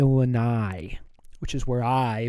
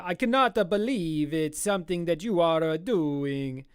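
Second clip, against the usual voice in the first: put-on Italian accent; 3 semitones higher than usual. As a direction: play an amazed Italian with a thick mock accent. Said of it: "That's a good Italian accent".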